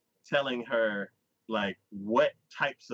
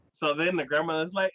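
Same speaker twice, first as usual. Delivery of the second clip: usual speaking voice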